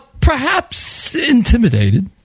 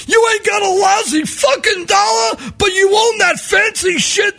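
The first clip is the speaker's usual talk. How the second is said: yelling; 11 semitones higher than usual. Retold or imitated. Panhandler yelling at the well-dressed man. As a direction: scream it with contempt. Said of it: "he's yelling this at the guy".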